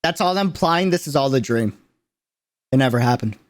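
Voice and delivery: ordinary talk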